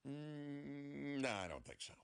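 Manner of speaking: long high hum, then a dropping no; reluctant refusal. Acted. Patient weighing the doctor's offer to stop, reluctant. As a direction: hem and haw before turning it down.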